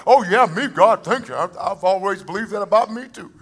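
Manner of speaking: hammed-up thankful reply; self-assured believer. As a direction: imitate someone happily taking the compliment. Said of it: "that kind of person who goes".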